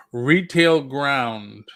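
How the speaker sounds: ordinary delivery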